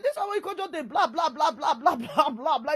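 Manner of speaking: high, fast mocking blah-blah; mocking scold. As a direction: mimic the kid's endless chatter, teasing. Mother mocks son's chatter.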